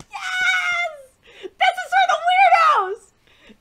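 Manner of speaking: high, loud, slowed cheer with a laughing sob; cheering the weirdos, half laughing, half crying; excited cheer. Acted. Classmate cheers for the weirdo class, excited.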